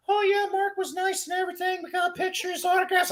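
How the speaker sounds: very high falsetto imitation of fans; mock the lucky fans in falsetto; high mimicking voice. Mimics happy fans with their autographs.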